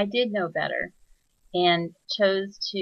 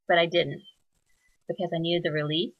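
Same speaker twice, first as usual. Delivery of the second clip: normal talking voice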